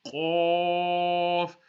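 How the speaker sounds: Arabic Qaf from the throat; thick Qaf. Shown as a demonstration. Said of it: "is a thicker sound".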